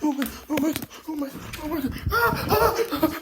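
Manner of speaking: high shrieking panic; panicked screaming. Pretend. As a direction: shriek in mock terror.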